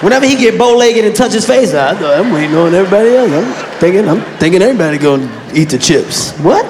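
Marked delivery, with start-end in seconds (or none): 1.7-6.3 low sheepish stammering excuse; dumb boyfriend excuse voice. Retold or imitated.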